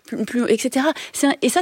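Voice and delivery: normal talking voice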